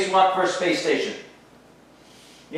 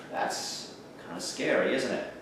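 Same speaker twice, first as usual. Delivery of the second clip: spooky whisper; 4 semitones lower, 11 dB quieter than usual. Acted. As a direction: lean in and whisper it like a secret. Teacher hushes ominous aside.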